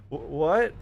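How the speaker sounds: startled. Retold or imitated. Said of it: "And you're like".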